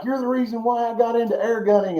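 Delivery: high, giddy retelling of the friend's glee; imitating excited friend. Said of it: "he starts freaking out".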